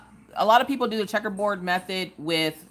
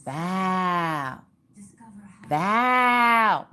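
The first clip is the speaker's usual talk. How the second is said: higher, lightly voiced cat meow; cat calling a name; 4 semitones higher than usual. Owner voicing her cat calling for her, a light higher meow. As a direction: call the name like a cat wanting attention. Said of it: "it will just keep calling you".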